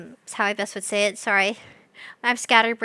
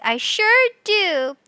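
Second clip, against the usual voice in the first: cheery; 7 semitones higher, 3 dB louder, much slower than usual. Acted. Cheery reply to chat.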